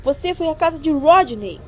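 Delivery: acusação gritada, alta e aguda; grito. A narrator's reading. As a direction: grite a acusação na cara dela. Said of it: "gritou Jeremy". (grito acusador)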